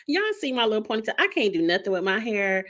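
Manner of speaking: usual speaking voice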